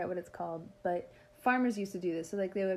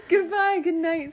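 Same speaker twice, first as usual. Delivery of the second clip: laughing; 10 semitones higher, 8 dB louder than usual. Real, not acted. Laughing sign-off, loud and high.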